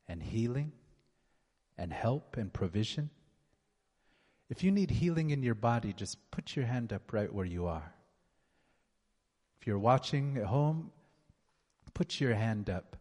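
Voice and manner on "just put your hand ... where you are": ordinary talk, nothing marked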